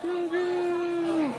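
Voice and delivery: drawn-out falsetto coo; high-pitched calling. Pretend. Host spotting Grogu, high cooing call. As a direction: coo the name in a tiny high voice.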